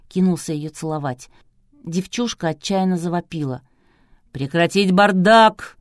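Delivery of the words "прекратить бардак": loud, drawn-out command; thunderous order. A narrator's reading. Producer orders an end to the chaos, thundering. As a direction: boom it out slowly as an order. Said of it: "громовым голосом приказал Клычко-Жиляев".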